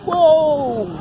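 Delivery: sudden high exclaim of surprise; startled whoa; surprised whoa. Real, not acted. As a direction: jump up in pitch at the drone's speed. Drone zips fast in sports mode.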